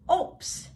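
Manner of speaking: "Obst" with the B said as a P; crisp teaching demo of "Obst", P in place of B; B pronounced as P. Shown as a demonstration. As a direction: say "Obst" like "opst", with a P sound. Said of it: "the B in the word is pronounced as".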